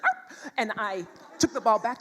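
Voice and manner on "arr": put-on high, squeaky kid's growl; a playful pitched-up arr; mock boy growl. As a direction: growl like a cornered kid, high. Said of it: "he was like".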